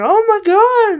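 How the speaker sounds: high, loud mock shock; voicing a startled bear, high and loud; mock shocked gasp. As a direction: squeal it like a startled cartoon bear. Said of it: "looking at the dummy going".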